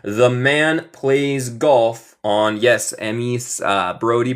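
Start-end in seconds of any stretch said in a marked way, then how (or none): 0.0-2.6 slow, spaced words left open for an answer; slow model reading. Shown as a demonstration. Teacher prompts students for the missing day, slow model reading. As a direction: read it slowly and leave the end hanging.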